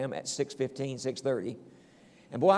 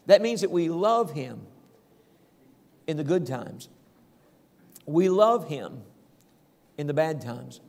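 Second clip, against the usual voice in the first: preaching. Acted. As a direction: preach it out to the congregation with fire. Preaching the point.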